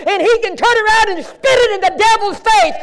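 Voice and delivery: rising into a shout; preaching at full force. Acted. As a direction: preach it out to the whole church.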